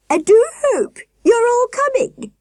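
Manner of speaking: very high warbling voice from a giant woman; very high, trilling sweet voice; warbling falsetto nurse. A narrator's reading. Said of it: "she repeated".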